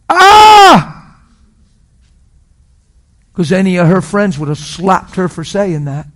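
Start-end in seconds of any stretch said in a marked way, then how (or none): 0.1-1.0 very loud, high sustained scream. Acted. Preacher reacting to the wife's apology, loud high scream. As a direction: let out a sudden full-force high yell. Sudden cry after the story.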